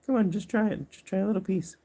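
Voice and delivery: high coaxing pet voice; sing-song sweet talk to a little animal. Real, not acted. Coaxing her guinea pig to eat carrot, in high baby talk.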